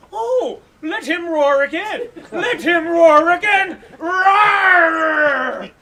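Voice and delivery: lion roar. Acted. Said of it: "in such a roar".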